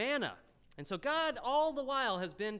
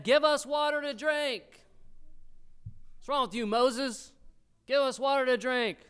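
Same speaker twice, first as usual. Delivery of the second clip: loud, raised, hammered-out demand; quarrelsome demand; 8 semitones higher, 7 dB louder than usual. Acted. Thirsty people confront Moses for water, quarrelsome and loud. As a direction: demand it loudly like an angry crowd. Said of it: "the people quarreled with Moses".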